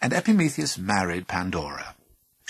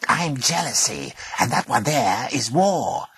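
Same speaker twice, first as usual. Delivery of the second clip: creature voice; 4 semitones higher, 5 dB louder than usual. Acted. Creature announces itself.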